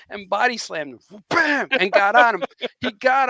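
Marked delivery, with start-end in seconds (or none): 1.3-1.7 loud, high impact exclamation; slam noise. Retold or imitated. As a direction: punch out a body-slam sound effect. Imitating the body slam impact.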